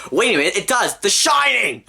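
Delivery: high, rising exclamation of discovery; mock realisation. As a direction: build to a high outburst of recognition. Mock discovery of another copy.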